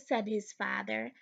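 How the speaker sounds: usual speaking voice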